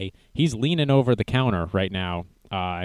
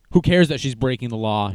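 ordinary delivery

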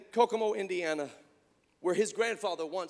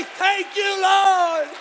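shouted praise; 12 dB louder, much slower than usual. Acted.

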